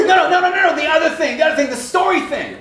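high, loud, insistent string of no's; excited correction. Retold or imitated. Professor hunting for the word testimony, excited. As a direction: grope eagerly for the word.

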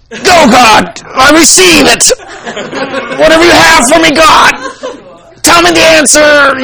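straining mock prayer. Man demanding an answer from God, straining shout. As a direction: strain as if forcing faith out. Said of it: "they'll do this".

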